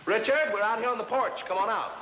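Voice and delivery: calling out. Acted. Calling to Richard in house.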